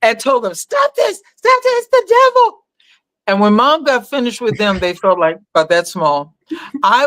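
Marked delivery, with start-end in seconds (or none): 0.5-2.6 high shrill mock cry of alarm, much higher; horrified mother screaming stop the devil's music; mother freaking out. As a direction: imitate a churchgoing mother shrieking to stop the song. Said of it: "My mother freaked out".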